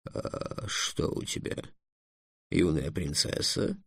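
low, slow, very soft dragon; dragon's voice. Acted. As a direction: murmur it slowly as a drowsy dragon. Dragon answers Trix from its rest. (тихий, низкий, медленный голос дракона)

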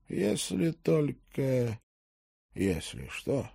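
soft, faltering and slow; timid, hesitant murmur; frightened babble. A narrator's reading. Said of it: "пролепетал белый как мел Бисбери".

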